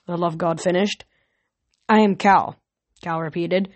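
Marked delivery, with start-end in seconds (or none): none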